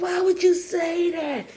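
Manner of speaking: high, drawn-out wailing complaint; mortified protest. Retold or imitated. Girl protests to mom after being embarrassed, mortified wail. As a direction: whine it slowly in horror.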